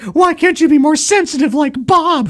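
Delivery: high, loud nagging wife. Retold or imitated. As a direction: whine it like a fed-up wife. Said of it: "It's like".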